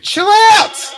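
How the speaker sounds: high, loud scolding shout; exasperated shout. Real, not acted. Host tells his noisy robot off, exasperated shout. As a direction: yell at a pest to settle down.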